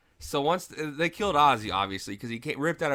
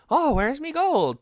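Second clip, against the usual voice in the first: high, slow Irish leprechaun voice; put-on Irish leprechaun, loud and high; Irish leprechaun imitation; 7 dB louder, slower than usual. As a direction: sing out in a pitched-up Irish lilt. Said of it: "trying to do a leprechaun imitation".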